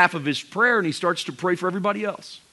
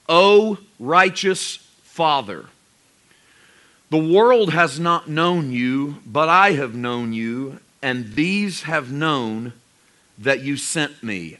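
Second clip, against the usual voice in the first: slow, weighty reading of the prayer; slow solemn proclamation; slower than usual. Shown as a demonstration. Preacher reading Jesus' prayer to the congregation, slow and solemn. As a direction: proclaim each phrase slowly and solemnly. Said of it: "feels like we're supposed to scream that".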